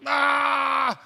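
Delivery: loud, high fake bawl; mock crying wail. Kid starts crying in the hallway, hammed-up wail. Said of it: "I just start crying".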